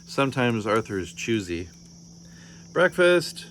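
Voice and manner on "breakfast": high, loud summons; calling out. A narrator's reading. Parent calling child down to breakfast, calling out. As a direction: call it out to someone out of the room. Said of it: "I call to Arthur".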